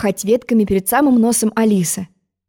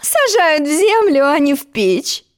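lecturing; 7 semitones higher, slower than usual. Acted. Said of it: "наставительно сказала".

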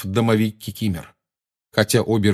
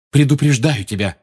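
warning; 5 semitones higher, 4 dB louder than usual. A narrator's reading. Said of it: "сказал Гарри".

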